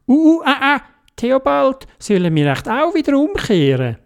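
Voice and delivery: high squeaky voice, a touch scared; high anxious Dodo. Acted. Dodo asking Theobald to turn back. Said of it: "der Dodo gesagt".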